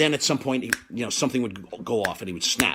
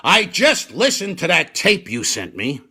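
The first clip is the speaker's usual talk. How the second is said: 1 Jerry on the phone; 5 semitones higher, 7 dB louder than usual. Retold or imitated. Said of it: "he says".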